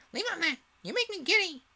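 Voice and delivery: very high and loud, exasperated; queen snapping at bowing gardeners; angry Queen. A narrator's reading. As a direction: scold them like a furious queen.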